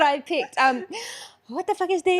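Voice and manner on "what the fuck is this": acted movie quote.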